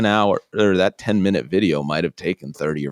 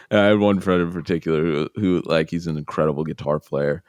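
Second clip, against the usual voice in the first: ordinary talk, nothing marked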